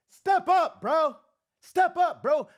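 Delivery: loud, high shout; yelled demand. Real, not acted. Fan yelling at the coach to step up. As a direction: bark it like a challenge.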